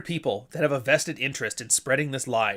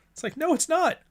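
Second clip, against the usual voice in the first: exasperated. Real, not acted. Rebutting in exasperation.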